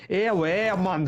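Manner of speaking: hammed-up Welsh voice mocking how they talk; a clumsy imitation of a Welsh accent. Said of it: "I don't know what accent that was".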